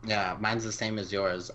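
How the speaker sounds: normal talking voice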